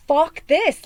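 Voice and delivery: loud fed-up cry; defiant outburst. Retold or imitated. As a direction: burst out fed up. People finally saying no.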